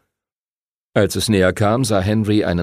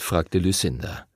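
usual speaking voice

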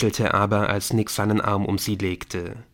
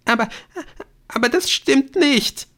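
very high tearful protest; high, upset girl's voice; sobbing, fighting for control; 4 dB louder than usual. A narrator's reading. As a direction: stammer it through held-back sobs. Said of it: "wie sehr sie sich zu beherrschen versuchte".

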